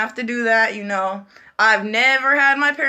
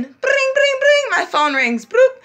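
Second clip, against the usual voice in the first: high sing-song ring ring; playful ringtone imitation; imitated ringtone; 5 dB louder, slower than usual. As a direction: mimic the phone ringing, pitched up. Phone ringing sound imitated.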